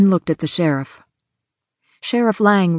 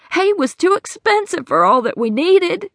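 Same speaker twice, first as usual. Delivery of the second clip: put-on raised, anxious older woman telling her troubles; Hattie's worried voice; 11 semitones higher than usual. Hattie explains to the sheriff how she lost money, anxious. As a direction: plead your story to the sheriff.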